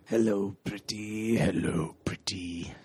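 quiet, low villain's catchphrase; Kurgan. Acted. Villain saying his trademark line, quiet low impression. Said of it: "trademark line".

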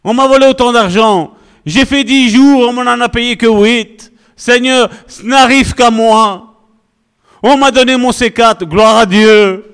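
a believer moaning about his boss in prayer; mock whiny prayer. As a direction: whine out your list of woes to God. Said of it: "À la place de dire".

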